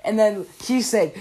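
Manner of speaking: ordinary delivery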